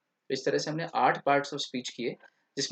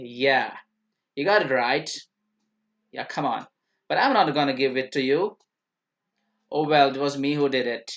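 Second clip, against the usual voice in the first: louder, slow American TV drawl; American accent; 5 dB louder, much slower than usual. Shown as a demonstration. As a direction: play a sitcom American. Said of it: "उनका एक अलग ही अंदाज होता है".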